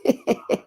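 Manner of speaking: a quick chuckle; short laugh. Real, not acted. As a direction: laugh it off lightly. Laughs after trying a cure.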